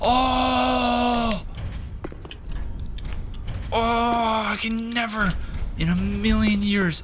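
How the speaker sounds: high, drawn-out cry of joyful relief; overjoyed relief. Real, not acted. Player finally finding the lost knight, real elated relief. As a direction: let it pour out high and slow, overjoyed. Finally finds the long-sought character.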